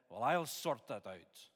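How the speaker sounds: imitated burly Scotsman, thick Scottish accent. Burly Scotsman on holiday offers to sort it, Scottish accent. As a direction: put on a Scots accent for the retold quote. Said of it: "That was a Scottish accent".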